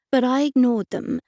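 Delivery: usual speaking voice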